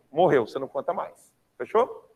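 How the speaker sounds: ordinary delivery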